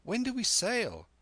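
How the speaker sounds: put-on high, eager boyish cry. A narrator's reading. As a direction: burst out like an impatient boy. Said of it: "cried I".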